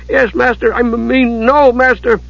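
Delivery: high-pitched, stammering servant; flustered. A narrator's reading. Monk rebuked by his master, flustered. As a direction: trip over the answer in a high voice.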